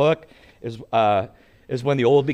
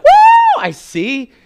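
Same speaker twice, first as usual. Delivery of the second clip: mock miracle whoop, a high squeal on woo; excited woo; 12 dB louder, much slower than usual. Preacher imitating a healed man's joyful whoop. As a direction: whoop like a healed blind man seeing. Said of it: "I would expect them to be like".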